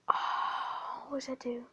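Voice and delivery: breathless, curious whisper; amazed whisper. Real, not acted. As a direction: gasp then high whisper. New item picked up.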